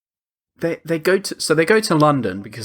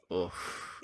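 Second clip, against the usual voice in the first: unvoiced cringe oof; 6 semitones lower, 18 dB quieter than usual. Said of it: "I was just like".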